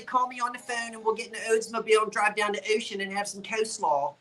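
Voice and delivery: quick, thick South Jersey twang. Retold or imitated. Seller mocks the twang she lost, thick South Jersey accent. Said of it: "That's very South Jersey".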